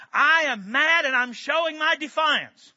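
loud, slow, defiant growl of a dog; mock defiant dog. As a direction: spell out the dog's protest, loud and deliberate. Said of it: "This is".

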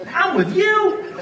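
mock exaggerated high. Shown as a demonstration. Preacher showing tones to the congregation, mocking high. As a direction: sing it out with mocking disbelief. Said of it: "same words, different meaning".

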